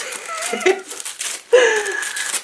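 long, very high squeal of laughter; overjoyed laugh. Real, not acted. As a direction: laugh helplessly, close to tears. Opens the gift she hoped for.

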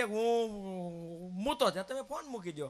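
slow, patient explaining to the caller. Retold or imitated. Telling the caller patiently, slow and drawn. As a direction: spell it out slowly.

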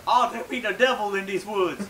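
high, loud caricature stage voice; mock a hammy play reading; imitating his Tituba voice. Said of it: "his voice was more like".

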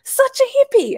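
mocking teen tease. Teen daughter teasing her hippie mum, mock sing-song. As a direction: rib her mum like a cheeky teenager. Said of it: "And she was like".

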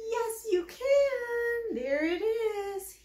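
high, slow delighted praise; gasp, then slow high cheer to the baby. Acted. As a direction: draw it out, thrilled for the baby. Praising baby finding chin.

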